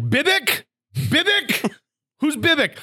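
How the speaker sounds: high-pitched searching call; imitated puzzled crowd hunting for someone; calling out a name.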